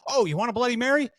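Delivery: high, fast, cheerful offer; imitating Howard. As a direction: toss off the offer quickly and brightly. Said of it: "and he goes".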